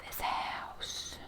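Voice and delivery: gasp, then a breathless whisper; hushed in awe of the house; whispering in wonder; awed whisper. Real, not acted.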